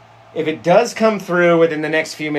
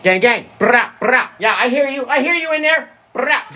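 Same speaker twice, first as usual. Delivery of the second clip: much higher, louder mocking streamer; 9 semitones higher, 4 dB louder than usual. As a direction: hype streamer impression, loud and high. Imitating the gang girl.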